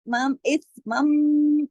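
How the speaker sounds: इस मम very slow, मम held in the nose; long ghunna. Shown as a demonstration. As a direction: hum the nasal in मम. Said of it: "अब ये है गुन्ना". (मम में लंबा गुन्ना)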